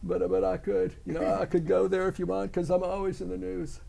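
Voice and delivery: lightly put-on public figure's voice; political celebrity impression. Impressionist answers host's request for a celebrity, lightly put-on voice. Trump impression after being asked.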